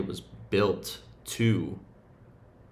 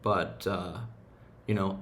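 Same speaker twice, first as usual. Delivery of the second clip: normal talking voice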